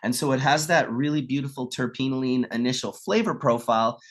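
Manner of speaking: normal talking voice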